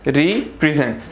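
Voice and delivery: रिप्रेजेंट as re, short pause, present; two-part split. Shown as a demonstration. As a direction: break रिप्रेजेंट only after RE. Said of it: "दो दफ़ा पढ़ने में आ रहा है".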